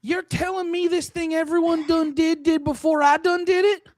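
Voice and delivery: high-pitched mock Southern indignation; high Southern lady voice, putting on disbelief; hammed-up Southern lady. Comedian as Southern lady hearing others did it first, mock accent. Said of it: "I'm still in Southern lady voice".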